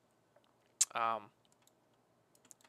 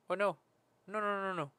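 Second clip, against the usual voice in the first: ordinary talk, nothing marked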